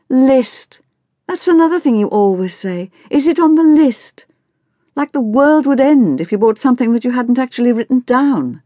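put-on high, scornful teen rant; exasperated teenage daughter. A narrator's reading. Daughter attacks her mother's shopping list habit, scornfully exasperated.

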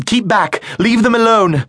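high, loud shout to drive off a beast; shouted, rising to a full cry; defiant shout. Acted. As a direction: yell it to protect your friends. Thomas confronts the monster.

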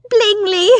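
high, loud, breathless society wife; fussy excited mother. Acted. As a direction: gush it out like an eager matchmaking mother. Mrs Bonnet names the man.

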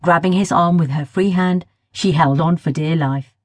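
ordinary delivery, nothing marked

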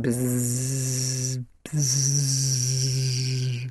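long, droning bee buzz; children's sustained buzzing; steady humming. A narrator's reading. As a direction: hold the buzz on one steady note. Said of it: "set up a steady humming".

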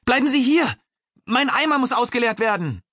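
urgent, very high-pitched calling. A narrator's reading. Prisoner to the guard about his full bucket, calling out. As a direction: call high after someone walking away. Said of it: "rief er".

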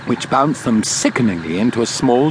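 ordinary delivery

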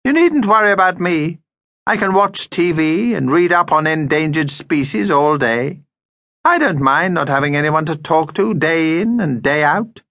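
high, martyred little vampire voice; self-pitying vampire. Acted. Vampire tells the family he'll stay home, martyred. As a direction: play the hard-done-by vampire, laying on the self-pity.

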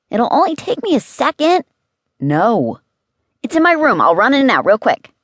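put-on high, eager child's voice; insistent, high-pitched pleading; pleading kid. A narrator's reading. Boy pleading to fetch his iPod.

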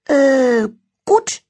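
high, nervous quick answer; nervous blurt. Acted. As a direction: blurt it out caught out. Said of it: "antwortete Elfried schnell".

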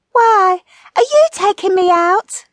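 high, loud, eager retort; loud high-pitched wifely challenge; sparring wife. A narrator's reading. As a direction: pipe up as a high-voiced comic wife.

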